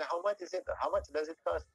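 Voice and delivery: lightly voiced tourist asking the price. Tourist asks the shop owner how much. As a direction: ask like a curious tourist. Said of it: "ketika ditanya sama turis".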